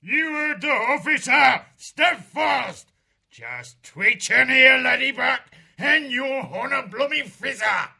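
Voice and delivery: high, loud parade-ground bellow; officers' roar. A narrator's reading. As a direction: roar the mob to attention. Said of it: "The roars of the three officers froze the crew".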